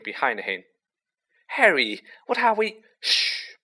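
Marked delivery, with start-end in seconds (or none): 1.5-2.8 alarmed. A narrator's reading. Ron running, alarmed. 3.0-3.6 hush. A narrator's reading. Harry hushing Ron.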